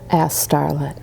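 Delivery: usual speaking voice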